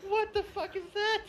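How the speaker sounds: very high-pitched, comic disbelief; retold incredulous outburst. Customer retelling his reaction to no bread, incredulous squeal. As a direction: send the question shooting up high, mock-outraged.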